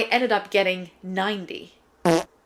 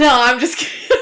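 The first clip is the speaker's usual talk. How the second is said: laughing it off, louder; laughing at her own joke; 7 semitones higher, 11 dB louder than usual. Real, not acted. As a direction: giggle through the take-back. Jokes about fake sponsor.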